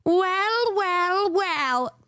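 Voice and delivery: high, drawn-out mock greeting; robot voice taunt. Robot startles her as she lies there, high teasing voice. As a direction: sing it out high, then let it fall. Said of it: "I hear a voice go".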